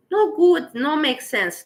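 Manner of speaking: high, loud exclamation; emphatic outburst. Real, not acted. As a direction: burst out with it. Repeats no good louder after.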